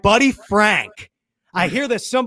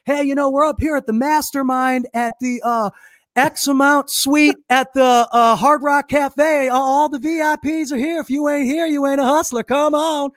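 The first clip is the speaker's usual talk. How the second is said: mock boastful invitation; 5 semitones higher than usual. Hustler luring VIPs to the suite, mock boastful invitation. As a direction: imitate a hustler calling out the VIPs.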